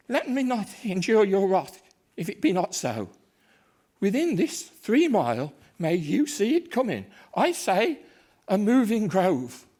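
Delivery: pleading messenger. Acted. Servant insisting to Macbeth, pleading and urgent. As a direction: stand your ground while begging.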